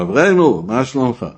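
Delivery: lightly hammed-up familiar greeting; hearty greeting. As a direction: hail him like an old pal. Acted greeting to the court's head.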